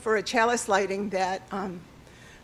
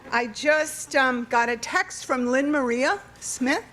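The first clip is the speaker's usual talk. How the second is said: ordinary talk, nothing marked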